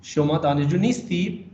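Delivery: نیستید pressed, falling; stress on نیستید. Shown as a demonstration. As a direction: hit the word نیستید. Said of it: "نیستید پہ آپ stress کریں گے".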